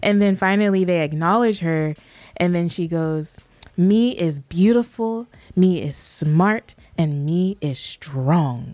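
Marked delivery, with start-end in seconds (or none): 3.8-8.7 slow, emphatic mimicked child's declaration; deliberate, spaced-out imitation of total conviction; imitating childlike conviction. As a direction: mimic a little one's proud affirmation, word by word. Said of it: "she says it with such conviction".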